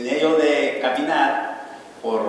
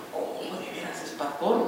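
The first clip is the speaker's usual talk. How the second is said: surprised whisper; 4 semitones higher, 8 dB quieter than usual. Retold or imitated. Young man surprised, whispered.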